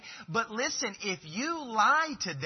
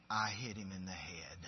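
soft, lower, grudging admission; a child reluctantly owning up to dad; reluctant child confession; 9 semitones lower, 11 dB quieter than usual. Retold or imitated. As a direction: mumble it like a guilty kid. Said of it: "response is very slowly and reluctantly".

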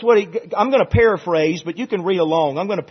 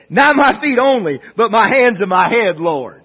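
big, impassioned acted plea; fervent plea; 3 semitones higher, 8 dB louder than usual. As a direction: play an over-eager disciple begging for more. Acts Peter's eager change of mind.

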